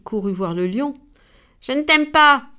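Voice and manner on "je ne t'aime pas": higher and louder, put-on voice; upset. The upset skunk runs to the lion, higher and louder. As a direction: blurt out the grudge. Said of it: "toute contrariée".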